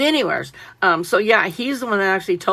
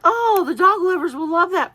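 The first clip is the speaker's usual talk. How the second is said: mock cheerful quote; 11 semitones higher, 4 dB louder than usual. Mock quote of her own thought.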